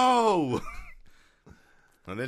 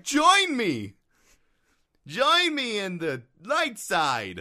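mock heroic call; 7 semitones higher, much slower than usual. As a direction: overblown call to the light. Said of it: "a good person being like".

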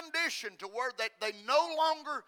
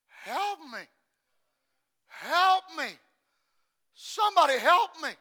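acted plea building from restrained to loud; imitated cry for help; 6 semitones higher, 9 dB louder than usual. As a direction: call out like old people pleading, growing louder. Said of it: "I hear old people screaming".

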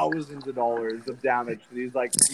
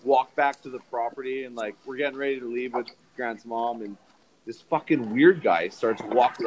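ordinary talk, nothing marked

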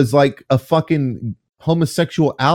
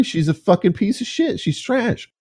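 high, soft sneering voice; mocking high voice; 7 semitones higher, 4 dB quieter than usual. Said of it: "supporters are gonna be like".

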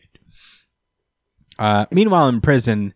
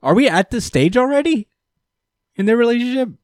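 high, incredulous question, pitch jumping up; mock disbelief; 12 semitones higher, faster than usual. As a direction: act baffled at the kiss. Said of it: "and I'm like".

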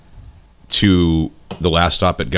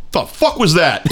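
higher, lightly voiced baffled guy; imitating stunned chaperone; 11 semitones higher than usual. Voicing the chaperone reacting after the lady leaves, mock disbelief. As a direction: toss it up high in mock disbelief.

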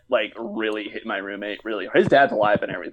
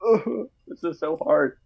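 mock anguish; 6 semitones higher, slower than usual. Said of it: "I was just like".

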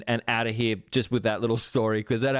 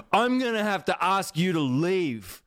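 a pitched-up, slowed, officious shop-worker voice; stiff staff member; 8 semitones higher than usual. Retold or imitated. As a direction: play the jobsworth employee reading out the rule. Staff member refusing the toilet.

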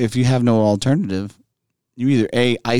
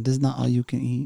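owner voice in a put-on Chinese accent; 2 semitones higher, 4 dB quieter than usual.

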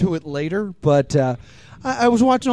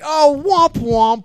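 high, loud, slow womp-womp; mockingly drawn-out and punched; mock dismissive womp; 7 semitones higher, 5 dB louder, much slower than usual. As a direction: drag it out like a sad trombone. Mock noise in retold reaction.

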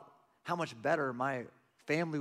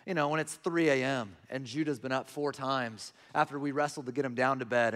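ordinary talk, nothing marked

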